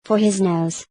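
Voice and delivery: usual speaking voice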